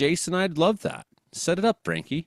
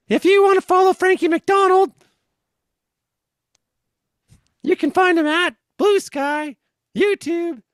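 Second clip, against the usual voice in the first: high, loud squeaky plug; helium voice; 6 dB louder than usual. Pretend. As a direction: read the plug in a balloon voice. Host still in helium voice.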